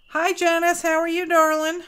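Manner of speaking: high, bright hello to a friend; cheery greeting. Real, not acted. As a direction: greet her sweetly and high. Greets viewer in live chat.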